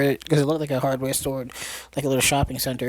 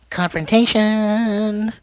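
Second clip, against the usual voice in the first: high, drawn-out, louder word; mock-dramatic announcement; 6 semitones higher, 4 dB louder, much slower than usual. Storyteller announcing the big showdown, playfully dramatic. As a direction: stretch it out like a showdown title. Teller announces the confrontation scene.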